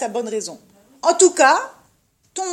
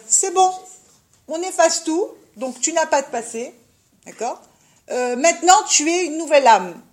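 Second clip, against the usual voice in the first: higher and louder, a falsely generous tempter; tempter's sweet promise; 5 semitones higher, 6 dB louder than usual. Retold or imitated. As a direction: sell the fresh start like a smooth talker. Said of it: "il va dire". (ton de tentateur faussement généreux, plus aigu et plus fort)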